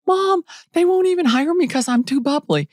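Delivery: high, wailing mock complaint to mom; whiny upset daughter. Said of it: "I was crying".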